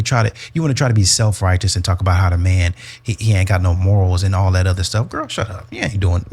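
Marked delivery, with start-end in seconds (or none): 5.1-6.3 sassy dismissal. Acted. Scolding the TikTok woman.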